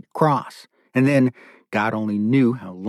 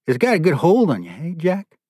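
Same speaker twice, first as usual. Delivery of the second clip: higher-pitched, companionable check-in; chummy; 6 semitones higher than usual. A narrator's reading. Captor testing the prisoner's chain, chummy as a workmate. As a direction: talk as if to a partner on a shared project. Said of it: "as though the two of them were working together".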